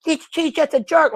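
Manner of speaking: hammed-up high nagging friend voice; mocking friend. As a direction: squeal it like a prissy friend talking her out of it. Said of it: "her friend's like".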